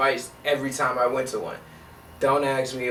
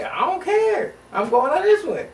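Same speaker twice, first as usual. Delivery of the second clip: defiant; 12 semitones higher than usual. Retold or imitated. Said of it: "I was like".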